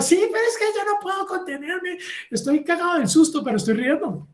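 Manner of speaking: high, giggly excuse between scared laughs; a visitor who can't stop laughing; laughing through fright. Retold or imitated. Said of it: "cuando se asusta se ríe". (voz aguda, riendo de nervios)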